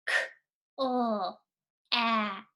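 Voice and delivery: "K, uh, ap" said as separate sounds; sounding out clap. Shown as a demonstration. Teacher pointing at letters, sounding out slowly. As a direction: sound out "K, uh, ap" one piece at a time. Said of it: "Sound this word out with me".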